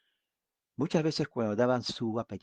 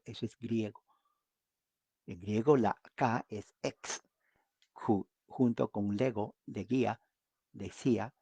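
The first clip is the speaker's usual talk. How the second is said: ordinary talk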